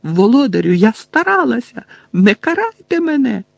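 high, frantic pleading; shrieking. A narrator's reading. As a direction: deny it, then beg loudly in panic. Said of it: "заверещала вона". (панічне благання високим голосом)

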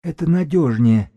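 high wheezy counsel; hoarse character's voice. A narrator's reading. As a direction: press the advice in a high husky voice. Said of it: "посоветовал Сиплый".

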